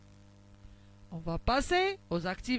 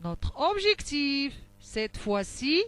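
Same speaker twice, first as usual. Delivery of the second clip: ordinary delivery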